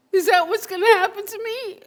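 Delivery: high, loud, voice breaking in tears; tearful crying. Acted. Seeing a dead girl as his future child, high tearful crying. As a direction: plead through sobs at the vision.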